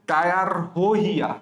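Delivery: ডায়ারোহিয়া slowly as "daya-ro-hiya"; pronunciation demo. Shown as a demonstration. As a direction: stretch each part of ডায়ারোহিয়া. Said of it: "আমি উচ্চারণ করছি কি দেখছো".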